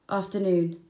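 ordinary delivery, nothing marked

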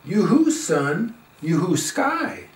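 usual speaking voice

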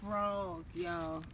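whispering the prank plan; hushed so she can't hear. Real, not acted. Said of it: "hushed prank setup to camera".

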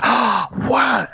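high, amazed gasp of a question; excited kids. Retold or imitated. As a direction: gasp it like thrilled children. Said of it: "And they all go".

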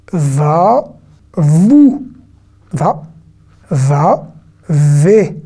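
VA, VOUS, VA read as separate syllables, vowels held; reading drill. Shown as a demonstration. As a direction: sound out each syllable for learners, pausing between. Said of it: "l'exercice de la lecture".

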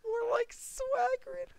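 slow, high and loud, collapsing into laughter; laughing punchline. Real, not acted. Names the picture Swagrid, laughing punchline. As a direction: land the joke name as you lose it.

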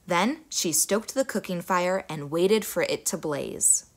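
usual speaking voice

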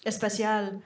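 "especial" as es-peth-YAL, softly; Spanish pronunciation. Shown as a demonstration. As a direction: show off "especial" in soft Castilian Spanish. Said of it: "That's Spanish for special".

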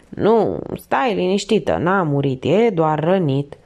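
ordinary delivery, nothing marked

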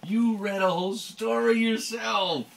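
loud, high, excited praise; proud praise. Real, not acted. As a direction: gush with pride over them. Mother praises the child proudly.

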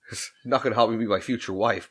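ordinary talk

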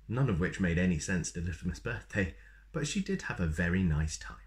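usual speaking voice

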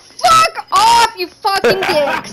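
shouted, laughing curses at rivals; swearing tirade. Real, not acted. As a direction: yell the insults in triumph. Celebrating clutch, cursing opponents.

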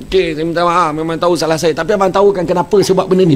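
wife justifying herself to husband; defensive wife. Retold or imitated. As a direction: rush to explain herself. Wife's louder defensive explanation.

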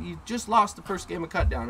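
ordinary delivery

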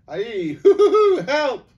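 high, loud whooping laugh breaking into a yelp; laughing shriek. A narrator's reading. Bree tickled by a whisker, whooping laugh that turns to a yelp. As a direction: laugh wildly as a whisker tickles his ear. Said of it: "one of Aslan's had actually tickled his ear".

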